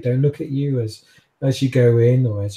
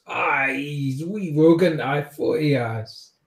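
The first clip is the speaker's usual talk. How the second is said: put-on thick Scottish accent; 3 semitones higher, slower than usual. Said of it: "such a thick accent".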